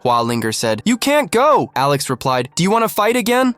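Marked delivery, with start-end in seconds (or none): 0.8-1.7 high, loud desperate cry; shouting. Acted. Hualinger calling after Alex as he leaves, high desperate shout. 2.5-3.5 challenging. Acted. Alex taunting Hualinger to fight again, fast high challenge.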